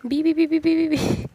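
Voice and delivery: high, loud rapid string of bees; Killer Bee's cry. Acted. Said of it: "he said".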